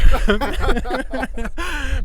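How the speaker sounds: high bursting laugh; hearty laugh. Real, not acted. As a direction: laugh out loud at yourself. Laughs at his own age.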